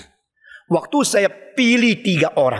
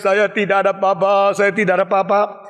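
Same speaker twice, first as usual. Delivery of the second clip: mock crying prisoner; 2 semitones lower than usual. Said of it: "Kalau mereka di situ menangis".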